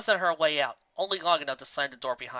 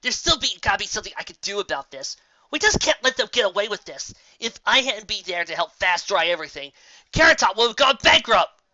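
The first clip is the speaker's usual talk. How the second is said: agitated pleading; 6 semitones higher, 7 dB louder than usual. A narrator's reading. Raindrops pleading, upset.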